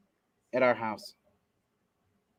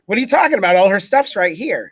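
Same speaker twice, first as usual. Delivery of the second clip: higher, louder feigned surprise; mock bewilderment; 4 semitones higher, 6 dB louder than usual. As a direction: act baffled, voice jumping up. Playing innocent in retold trick.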